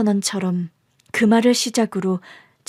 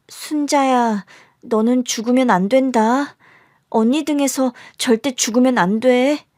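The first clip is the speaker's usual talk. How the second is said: put-on higher-pitched, earnest child's voice; pleading young sister; 4 semitones higher than usual. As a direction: beg the baby to hold on. Child pleads with baby on back. (동생에게 애원하는 어린 언니 목소리)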